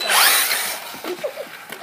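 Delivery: sudden high burst of laughter. Real, not acted. Race start goes wrong.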